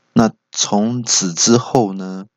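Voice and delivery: ordinary delivery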